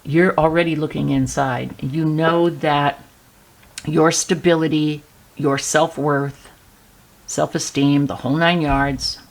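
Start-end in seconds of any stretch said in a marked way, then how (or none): none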